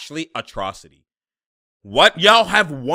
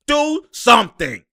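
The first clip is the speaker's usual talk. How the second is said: slow, loud and higher, begging; louder pleading; 7 semitones higher, 5 dB louder than usual. Real, not acted. Fan begging his team on TV, louder and slower. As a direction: drag it out like a desperate plea.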